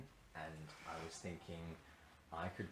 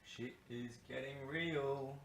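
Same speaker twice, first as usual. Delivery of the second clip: mock dramatic; 7 semitones higher, 3 dB louder, much slower than usual. Mock dramatic slow line.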